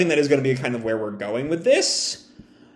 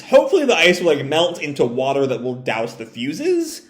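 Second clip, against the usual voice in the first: ordinary talk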